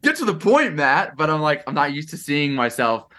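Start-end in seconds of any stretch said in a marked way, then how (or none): 0.0-1.2 high-pitched urging, aimed at oneself; mock impatient. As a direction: playfully hurry yourself along in a high voice. Acts out impatience at slow reel.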